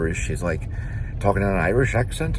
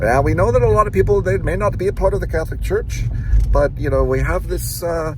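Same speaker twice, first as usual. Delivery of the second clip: put-on Irish accent; 10 semitones higher than usual. Salesman pitching Bibles to customers, in imitated Irish accent. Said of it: "he would go into an Irish accent".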